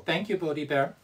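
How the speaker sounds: ordinary delivery, nothing marked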